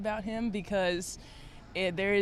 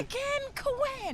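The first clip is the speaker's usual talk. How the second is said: Coach Peretta yelling; much slower than usual. Retold or imitated. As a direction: drag the name out, high and slow. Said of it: "your best Coach Peretta impression following a turnover".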